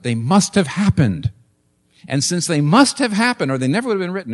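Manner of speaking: usual speaking voice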